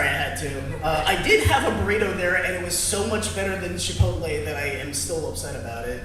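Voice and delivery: ordinary talk, nothing marked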